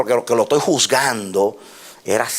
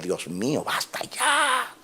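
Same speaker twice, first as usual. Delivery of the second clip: crying out; 4 semitones higher, 7 dB quieter, much slower than usual. Real, not acted. Preacher crying out to God, voice breaking. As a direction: voice breaking high into a cry.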